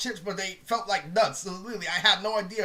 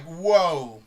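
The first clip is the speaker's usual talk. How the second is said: amazed whoa; 3 semitones lower, 5 dB louder than usual. Retold or imitated. Amazed at favorite color match.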